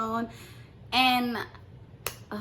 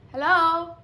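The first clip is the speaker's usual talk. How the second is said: high, loud calling hello; louder hello; 8 semitones higher, 8 dB louder than usual. Retold or imitated. Calling the scammer's number, a high loud hello. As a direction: call out hello into the dead line.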